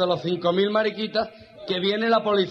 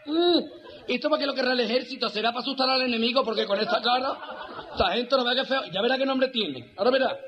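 higher-pitched effeminate grumbling; camp army clerk; 5 semitones higher than usual. Acted. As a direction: fuss in a high camp voice. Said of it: "La mariquita que la ve".

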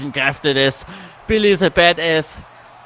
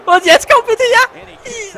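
much higher and louder, straining with excitement; excited high yell; 10 dB louder, much slower than usual. Real, not acted. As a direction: squeal as the move lands. Excited game moment. (aufgeregt, hoch und laut gerufen)

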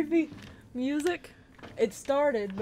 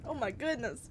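ordinary talk, nothing marked

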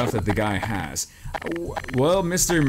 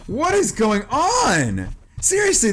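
high startled exclaim; 10 semitones higher, much slower than usual. Real, not acted. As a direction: jump at the scare. Startled by jump scare.

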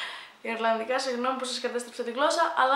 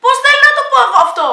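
much higher, louder and fast, fed up; exasperated; 12 semitones higher, 15 dB louder, much faster than usual. Real, not acted. Vlogger giving up on a word, exasperated fast outburst. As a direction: blurt it out in frustration.

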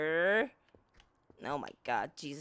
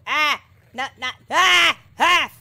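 panicked screams; 4 semitones higher, 10 dB louder than usual. Real, not acted.

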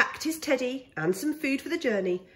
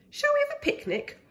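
much higher and quick, eager cub; Baby Bear voice; faster than usual. Acted. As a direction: pitch it up like an excited little bear. Baby Bear suggests picnic.

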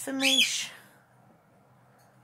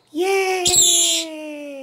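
loud, high shouted yay; big happy shout; loud cheer; 8 semitones higher, 7 dB louder than usual. Real, not acted. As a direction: celebrate with a whoop. Cheers after song.

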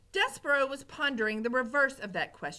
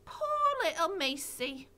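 put-on slow, a bit higher pitying girl; lightly voiced Mig; 7 semitones higher, slower than usual. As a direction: coo over the drowned mouse, slowly. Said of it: "she said".

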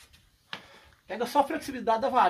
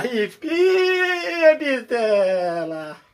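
high cry then long drawn bitela; excited shout; 4 semitones higher, 9 dB louder, much slower than usual. Real, not acted. Showing off a giant fish on camera, whooping. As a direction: whoop it out, stretch the last word slowly.

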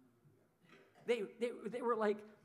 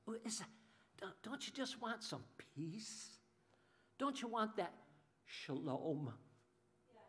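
gentle appeal; 8 dB quieter than usual. Real, not acted. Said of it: "pastor gently appeals about peace".

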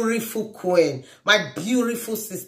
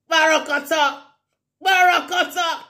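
hype shout; 8 semitones higher than usual. Acted.

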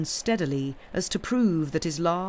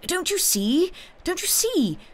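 very high, urgent pleading; frantically eager, pitched far up; feverish cry; 13 semitones higher than usual. A narrator's reading. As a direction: cry it out, trembling with excitement. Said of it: "he cried feverishly".

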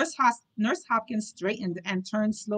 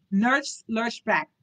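ordinary delivery, nothing marked

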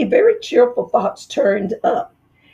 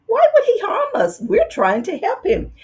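much higher, innocent wife voice; naive wife; 14 semitones higher than usual. Acted. Dentist's wife questioning her husband, high and innocent. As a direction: ask with wide-eyed trust.

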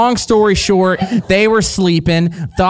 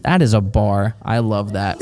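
ordinary talk

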